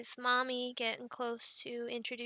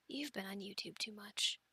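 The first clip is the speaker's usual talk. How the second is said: whispered aside; 5 semitones lower, 8 dB quieter than usual. Real, not acted. Aside to camera.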